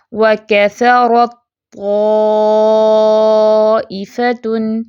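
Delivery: very slow recitation, ت merged into ط, long drawn طَائِفَةٌ; tajweed recitation. Shown as a demonstration. Teacher demonstrates how to read it, very slow drawn-out recitation. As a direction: recite وَكَثَرَتْ طَائِفَةٌ with no ت heard. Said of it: "تو ہم پڑھتے کیسے ہیں".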